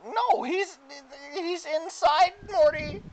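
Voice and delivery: high, loud cartoon helper protesting; Mister Meeseeks. Game master as Meeseeks answering the player, high protesting Meeseeks voice. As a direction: object in a very high voice. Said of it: "He summons another Meeseeks".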